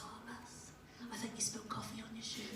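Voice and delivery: softly voicing a child pointing out a stain; a quiet, lightly voiced pupil; hushed kid. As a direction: kid murmuring to the teacher, hushed. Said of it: "One of the kids goes".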